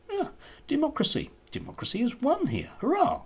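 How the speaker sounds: high, mock-triumphant hurrah; sarcastic victory cheer.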